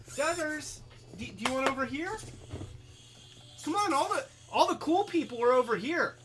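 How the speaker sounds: high, quick coaxing pet voice; talking to a cat. Real, not acted. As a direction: coax the cat over, sing-song. Calling the cat over.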